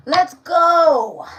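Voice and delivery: sudden shouted let's go, high; hype shout. Real, not acted. Tarot reader kicking off her live reading, shouting. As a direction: blast it out to start the show.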